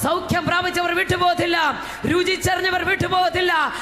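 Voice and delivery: fiery preaching. Real, not acted. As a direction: build each line like a rallying cry. Preaching at full force.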